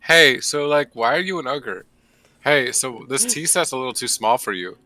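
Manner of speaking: lightly voiced chirpy questioner; Lotus voice. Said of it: "lovely Lotus is like".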